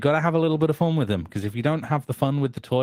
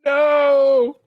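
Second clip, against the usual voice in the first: panicked shout; 14 semitones higher, 8 dB louder than usual. Acted. Flashbang threatened.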